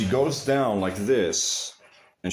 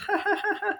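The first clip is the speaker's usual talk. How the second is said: high mocking laugh. Mocking laugh after taunt.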